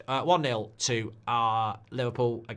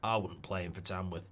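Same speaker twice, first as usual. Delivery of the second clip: low, quiet Australian manager voice; Ange impression; 4 semitones lower, 8 dB quieter than usual. Streamer doing a football manager for the chat, low Australian impression. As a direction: drop it down and mumble like an Aussie coach. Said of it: "I can do a bit of Ange".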